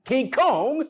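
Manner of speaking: lightly voiced movie impression, pitched high and loud; Denzel impression. Coach quoting Denzel from Training Day, high impression. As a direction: call it out high, hinting at Denzel. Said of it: "You Denzel from Training Day now".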